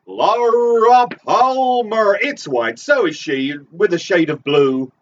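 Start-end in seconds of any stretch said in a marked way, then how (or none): 0.0-2.2 high, slow, drawn-out echo of the name; hammed-up echo. Echoing the caller with a high, slow, drawn-out name. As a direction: stretch it out high like a game show host.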